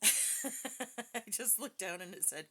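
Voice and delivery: words bubbling through a real laugh; can't keep the giggle out of it. Real, not acted. Reader tells viewers what the spirit box said, laughing.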